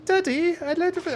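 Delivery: put-on high, childlike British accent. Dad imitating his daughter's British accent at a restaurant, high and childlike. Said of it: "she had a British accent".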